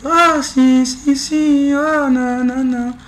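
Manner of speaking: very high falsetto chant; man doing a woman's voice. Shown as a demonstration. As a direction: sing it up high like the women's chorus. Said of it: "eu tô fazendo uma voz de mulher".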